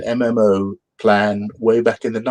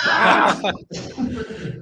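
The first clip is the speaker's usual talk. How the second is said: monster shriek; 13 semitones higher, 3 dB quieter than usual. Acted. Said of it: "Even more".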